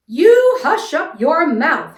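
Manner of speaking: loud, slow, high royal roar; stretched, thunderous telling-off; howled command. A narrator's reading. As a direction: howl it like an outraged king. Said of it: "howled the mighty King Yertle".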